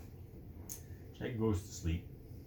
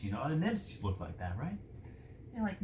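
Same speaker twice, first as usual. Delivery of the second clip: ordinary talk, nothing marked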